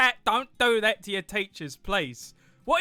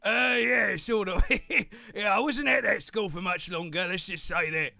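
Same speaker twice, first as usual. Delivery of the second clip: higher, louder merchant goodbye; cheerful merchant farewell; 5 semitones higher, 7 dB louder than usual. Acted. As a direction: merchant waves everyone off. Merchant signs off.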